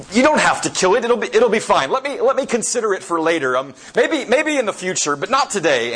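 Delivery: high, nervous bargaining voice; wheedling spirit. Retold or imitated. Spirit begging the angel to wait, high and nervous. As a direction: stall him with anxious excuses.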